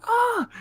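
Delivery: very high, loud imitated yelp; mock yelp. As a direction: squeal like someone just kicked. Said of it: "she just like".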